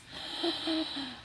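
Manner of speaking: sharp intake of breath in awe; real gasp of wonder at the present; delighted gasp. Real, not acted. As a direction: gasp as the gift appears. Unwrapping a gift.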